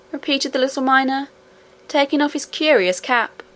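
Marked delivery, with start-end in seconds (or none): none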